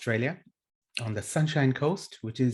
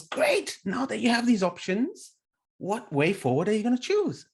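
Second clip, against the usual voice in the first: mock delighted coach, far higher on Great; cheery coach voice; 9 semitones higher than usual. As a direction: gush praise then ask, like a keen coach. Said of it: "And then the coach goes".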